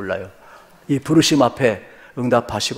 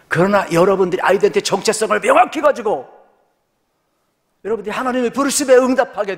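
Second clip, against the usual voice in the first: high, raised preacher's voice; full-force preaching; 10 semitones higher, 5 dB louder than usual. Real, not acted. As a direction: drive the exhortation home to the congregation.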